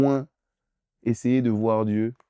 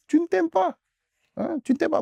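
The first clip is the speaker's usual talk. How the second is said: pitched way up, pressing it home; high insistent. Real, not acted. Preacher presses the congregation, high and insistent. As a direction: push the point higher each time.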